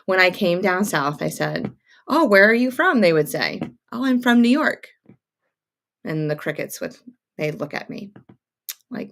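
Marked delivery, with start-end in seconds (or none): 2.1-3.0 imitating a curious stranger, higher and louder; retold friendly question. Stranger down south asking where she's from, retold friendly question. Retells being asked where from. 3.9-4.9 replaying a chirpy reply, higher pitch; retold chirpy answer. Newcomer answering where she's from, retold chirpy answer. Retells her own eager answer.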